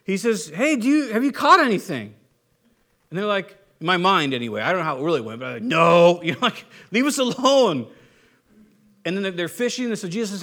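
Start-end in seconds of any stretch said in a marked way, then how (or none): 0.5-2.1 raised, louder hailing call. Acted. Jesus on the shore to the fishermen, calling out. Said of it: "he calls out to them". 5.6-6.2 much louder, drawn-out no; annoyed shout back. Acted. Fishermen answering a call from shore, loud mock annoyance. 6.9-7.9 higher, louder mock irritation; annoyed shout back. Fishermen yelling back to shore, mock annoyed.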